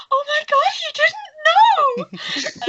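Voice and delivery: very high, drawn-out gasping shriek of disbelief; gasp, then squeal it out, stretching the last word; over-the-top high-pitched shock; shocked Meredith. Acted. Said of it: "Meredith's like".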